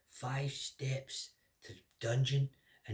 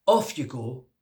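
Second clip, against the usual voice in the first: loud royal order; 13 dB louder, slower than usual. Acted. King to the fat man, loud dismissive order. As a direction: bark it out loud.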